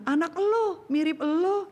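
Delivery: put-on husband teasing wife the child takes after her; husband blaming. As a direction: pin the bad kid on the wife. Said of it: "si suami bilang".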